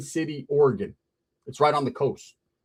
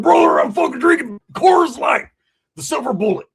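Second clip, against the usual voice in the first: much higher, loud, boozy bragging; drunk dad voice; 10 semitones higher, 6 dB louder than usual. Retold or imitated. Drunk dad boasting at the family birthday, loud high voice. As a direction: ham up a faded dad showing off his beer.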